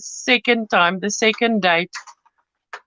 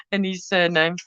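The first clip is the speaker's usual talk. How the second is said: usual speaking voice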